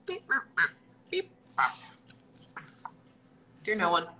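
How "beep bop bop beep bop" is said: robot beeps. Pretend. Robot noises.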